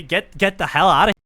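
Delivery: ordinary talk, nothing marked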